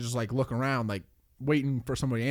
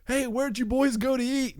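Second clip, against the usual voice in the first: high, mock-called question from an old lady; calling old lady; 11 semitones higher than usual. Old lady calling up to the boys, lightly mimicked. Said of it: "she yelled up to them".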